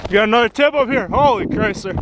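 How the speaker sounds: high, loud surprised call; excited shout. Real, not acted. Friend spotting another tip-up flag, excited. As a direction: shout the news to the group.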